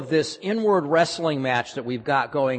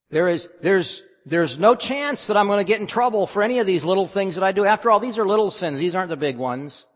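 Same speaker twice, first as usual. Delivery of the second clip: high, fast, louder rationalizing; self-justifying protest; 5 semitones higher, 5 dB louder than usual. Acted. Sinner excusing little sins to himself, hurried self-justifying. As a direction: rattle off excuses in a raised, hurried pitch.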